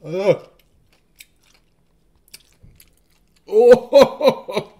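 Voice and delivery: wincing at a sour bite; eating sour candy. Real, not acted. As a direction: react through a sour mouthful. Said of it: "It's so sour".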